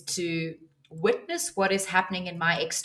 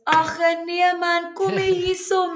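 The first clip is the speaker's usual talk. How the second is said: put-on slow, much higher Afrikaans-accented old man; South African accent; 11 semitones higher, 5 dB louder, slower than usual. Imitating the burly old man calling her over, slow South African accent. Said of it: "speaking in his accent".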